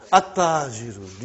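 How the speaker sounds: "At-Tajiru" with no l, straight onto a doubled t; lam skipped. Shown as a demonstration. Teacher contrasting with Ba, "At-Tajiru" with lam skipped. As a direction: skip the lam in "At-Tajiru" and hold the t. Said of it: "You did not pronounce Al".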